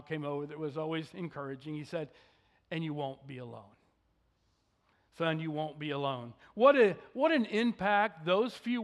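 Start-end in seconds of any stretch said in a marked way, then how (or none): 2.7-6.3 softer, quiet and gentle, like a father calming his son; calming fatherly voice. Retold or imitated. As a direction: ease him gently, no push. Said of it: "in a very calming voice".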